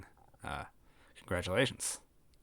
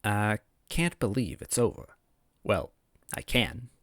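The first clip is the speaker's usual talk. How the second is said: steady first-person storytelling read; calm narrator voice; story narration; 6 dB louder than usual. A narrator's reading.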